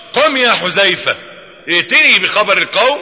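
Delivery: stern command. Retold or imitated. The Prophet sending Hudhayfa on a mission, stern command. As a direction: give the order with full seriousness. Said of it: "أمر بمنتهى الصرامة".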